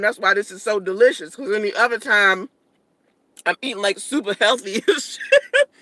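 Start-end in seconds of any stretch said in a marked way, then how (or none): 4.0-5.7 laughing. Real, not acted. Laughs mid-line.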